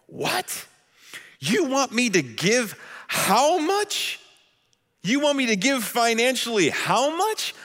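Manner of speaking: high, rising disbelief at each request; mock incredulous. Preacher imitating a believer balking at God's requests, high and incredulous. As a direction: squeak the What in disbelief. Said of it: "a little something like this".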